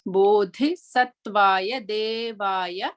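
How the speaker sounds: Bodhi Sattvaya Devaya very slowly, with an aspirated dha; careful pronunciation. Shown as a demonstration. Teacher models the third quarter for students, very slow. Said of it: "to hear the clear pronunciation".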